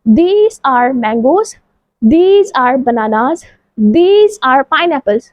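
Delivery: usual speaking voice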